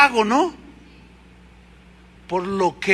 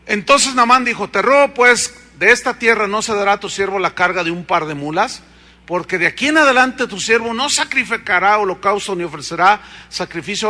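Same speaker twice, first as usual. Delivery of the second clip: preaching scripture; 5 dB louder than usual. Acted. Reads verse loudly.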